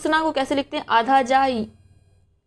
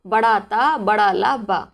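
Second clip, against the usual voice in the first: slow spelled-out word; much slower than usual. Shown as a demonstration. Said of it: "बल्कि कैसे लिखते हैं".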